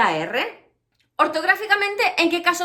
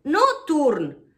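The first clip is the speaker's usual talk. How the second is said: ordinary talk, nothing marked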